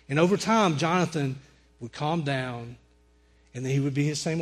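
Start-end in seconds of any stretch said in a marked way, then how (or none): none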